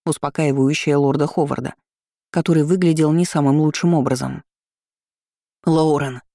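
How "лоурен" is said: usual speaking voice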